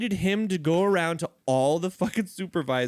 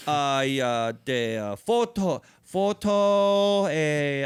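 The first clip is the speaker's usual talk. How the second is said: halting broken English; 4 dB louder, much slower than usual. Retold or imitated. Imitating worker who speaks no English.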